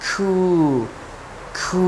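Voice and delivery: খু with an airy k and long oo; gently drawn-out খু; soft, gentle Q. Shown as a demonstration. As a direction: ease into খু and stretch it. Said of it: "very softly and gently you have to pronounce".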